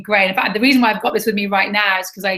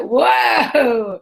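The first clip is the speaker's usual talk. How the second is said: retold whoa; 3 semitones higher than usual. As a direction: blurt out a surprised whoa. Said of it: "but I was like".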